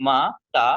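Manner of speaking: মাতা broken into মা and তা; two syllables. Shown as a demonstration. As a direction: say মাতা as two separate beats. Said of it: "দুইটা ক্ষুদ্র উচ্চারণ হয়".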